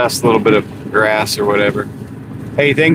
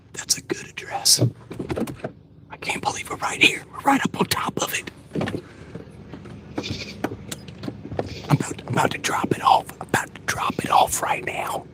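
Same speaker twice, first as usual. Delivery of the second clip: hushed TV-hunter whisper, higher; deer hunter show voice; 7 semitones higher, 11 dB quieter than usual. Pretend. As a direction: whisper like a hunting show host stalking deer. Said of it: "The deer hunting, like, show voice".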